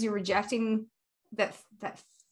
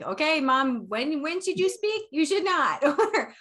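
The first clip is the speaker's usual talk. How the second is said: higher, louder mock telling-off; mock scolding; 6 semitones higher, 5 dB louder than usual. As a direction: playfully lecture a mom. Voicing her bossy reel advice.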